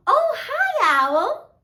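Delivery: put-on high, excited hello for a children's show; pretend delighted greeting. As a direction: greet an imaginary friend with playful delight. Greets pretend caller on phone.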